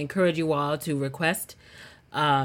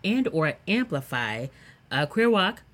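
ordinary talk, nothing marked

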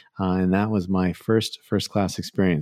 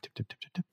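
whispered typing noise; 17 dB quieter, faster than usual. Retold or imitated. Imitating typing again.

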